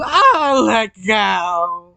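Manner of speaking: high acted scream falling into a plea; storyteller's scream of someone grabbed. A narrator's reading. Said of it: "Miyanaru just screamed".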